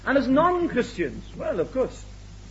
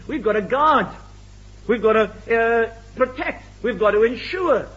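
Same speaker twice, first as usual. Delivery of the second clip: ordinary talk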